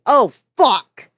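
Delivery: sudden loud, high shout; angry bark. A narrator's reading. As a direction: bark the curse out angrily at full force. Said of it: "suddenly barked an angry".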